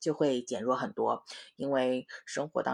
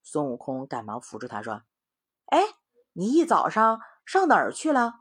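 story reading; 6 semitones higher, 5 dB louder than usual. A narrator's reading. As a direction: lively voice reading to children. Mother reads story to children. (给孩子讲故事的生动语气)

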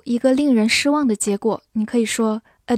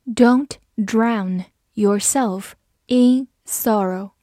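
slow, careful, word by word; slow reading; much slower than usual. Shown as a demonstration. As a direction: read it slowly so learners can repeat. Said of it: "好，慢读一遍". (慢速清楚地读)

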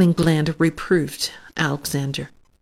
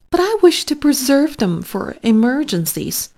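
doubtful; 7 semitones higher than usual. Acted. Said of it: "said Pickling Bland doubtfully".

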